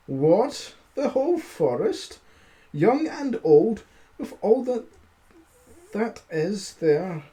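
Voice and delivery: high, rising cry of alarm; a frightened giant who can't believe it; giant's alarmed outburst. Acted. Giant balks at the tailor's boast, high alarmed cry. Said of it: "growled the credulous giant".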